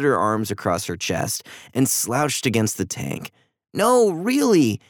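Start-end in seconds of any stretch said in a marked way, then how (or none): 3.7-4.8 high, drawn-out earnest plea; earnest insistence. A narrator's reading. Andy apologising to his upset sister, earnest insistence. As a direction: insist on it, slowly and higher. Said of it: "Andy insisted".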